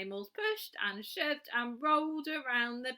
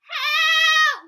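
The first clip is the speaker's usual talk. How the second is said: much higher, loud panicked cry; shout; 19 dB louder than usual. Acted. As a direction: yell for help in fright. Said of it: "Cried the animals".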